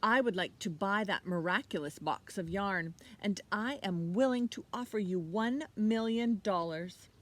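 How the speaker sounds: a hint of a haughty nobleman making an offer; lightly voiced archduke. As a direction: speak like a proud rich lord. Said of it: "said the archduke".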